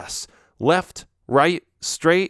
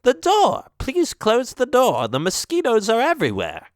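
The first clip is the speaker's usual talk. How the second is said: fussy witch voice; 11 semitones higher than usual. Acted. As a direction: scold like a fussy old witch.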